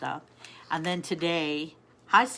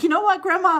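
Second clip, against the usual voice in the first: put-on higher and louder, a young girl's eager question; granddaughter's voice; 14 semitones higher, 10 dB louder than usual. Grandma retelling granddaughter's words, higher and louder child voice. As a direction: imitate the grandkid calling Grandma. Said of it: "she said it like so".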